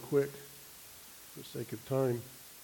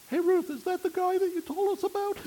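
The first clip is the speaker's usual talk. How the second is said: put-on much higher teasing girl's call; teasing dorm girl; 4 dB louder than usual. Dorm girl teasing Ruth about her date, high playful call. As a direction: pipe up high and playful from the window. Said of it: "one of them pipes up and says".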